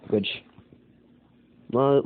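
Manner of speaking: normal talking voice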